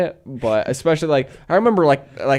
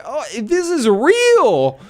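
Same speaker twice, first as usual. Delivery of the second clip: dawning horror; 10 semitones higher, much slower than usual. Retold or imitated. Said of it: "You're like".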